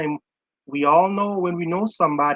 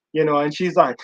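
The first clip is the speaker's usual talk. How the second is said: ordinary delivery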